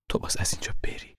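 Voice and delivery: soft pleading whisper. Acted. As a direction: breathe it out. Mother whispering to son.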